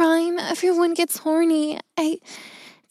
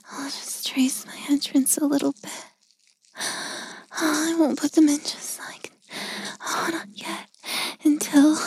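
breathy secret whisper. Acted. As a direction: hush it, aroused and sneaky. Secret whispered arousal in closet.

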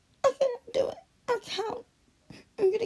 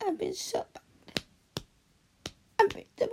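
usual speaking voice

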